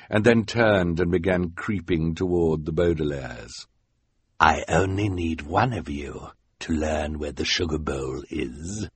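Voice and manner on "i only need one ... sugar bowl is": lower, quiet menacing voice; sinister villain. Acted. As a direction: creep closer with greedy menace. Count Olaf creeps toward them.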